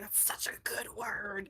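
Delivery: slow, awed-sounding whisper; hushed retold thought; whispered aside. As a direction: whisper it slowly to yourself. Quoting own delighted thought.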